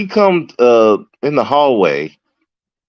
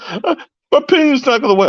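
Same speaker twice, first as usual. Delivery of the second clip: high, fast imitation of a crying man; mock crying; 11 semitones higher, faster than usual. Officer mimicking the injured inmate, mock crying. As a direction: imitate a panicked inmate's tearful wail, pitched way up. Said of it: "He's crying like".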